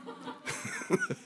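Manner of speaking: a high, disbelieving laugh; incredulous laugh. Real, not acted. Pastor laughing in disbelief at the boyfriend. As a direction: laugh at how pathetic he is.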